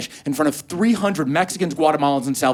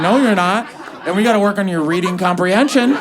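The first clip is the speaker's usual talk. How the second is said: mock scolding; 5 semitones higher, 4 dB louder than usual. Friend mocking him loudly.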